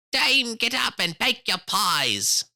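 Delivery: high, loud, slow rooster call; cock crying out. A narrator's reading. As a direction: crow it out like a rooster waking the house. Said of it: "the cocks cried out".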